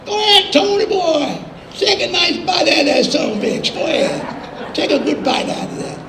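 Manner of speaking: high, wheezy egging-on voice; high-pitched mock goading. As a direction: imitate a country friend barely holding in laughter. [name] eggs him on, laughing.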